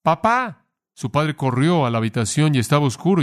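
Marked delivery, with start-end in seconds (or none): 0.0-0.7 put-on high-pitched child's call for Papá; retold frightened cry. Child waking from a nightmare calls his father, retold cry. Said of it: "Y él gritó".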